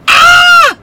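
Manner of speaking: very high, loud joyful shriek; excited shriek. Real, not acted. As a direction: let out a thrilled scream. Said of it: "I am so excited".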